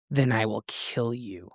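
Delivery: low, quiet menace; seething. A narrator's reading. The heroine threatening Bastien, seething low and quiet. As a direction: say it under her breath with hate. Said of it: "I seethed".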